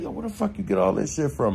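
a hint of a stunned friend; mock disbelief. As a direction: toss it off like you can't believe it. Friends question Football.

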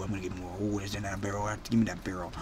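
very low, soft greedy mumble; low muttering. Real, not acted. Greedy muttering before outburst.

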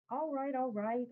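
put-on impatient older brother, a touch irritated; crossly. A narrator's reading. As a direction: brother scolds crying little boy, mildly cross. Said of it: "I say crossly".

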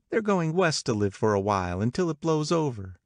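high, lively gossiping woman; gossipy Catherine. Acted. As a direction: pitch it up like eager party gossip.

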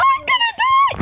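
high, loud panicked cry; panicked yell. Real, not acted. Kid yelling in panic as the killer attacks.

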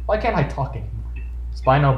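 usual speaking voice

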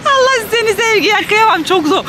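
high, crying out in sympathy; pitying cry. Real, not acted. Crying out in pity for her struggling sister.

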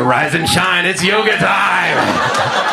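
slow, drawn-out menacing singsong; creepy cellmate. Retold or imitated. Imagined cellmate in jail at night, slow creepy taunt. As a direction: taunt him awake slowly.

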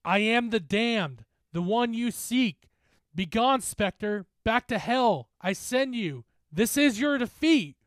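forceful, measured chant; shouted incantation. A narrator's reading. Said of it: "I started shouting the words".

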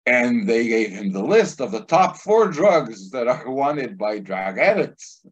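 ordinary talk, nothing marked

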